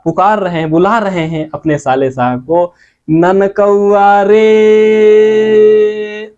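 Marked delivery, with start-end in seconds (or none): 3.1-6.3 ननकौआ रे with रे held very long; brother-in-law calling out to his wife's brother, रे held long; long drawn call. Retold or imitated. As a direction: call out across the field, stretching रे. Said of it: "रे करके कुछ देर तक खींचा जा रहा है".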